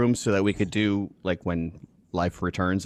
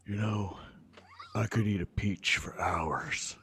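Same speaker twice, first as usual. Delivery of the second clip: Nicolas Cage whisper; 7 dB quieter, slower than usual. Retold or imitated. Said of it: "Nicolas Cage as Superman just looks at someone and goes".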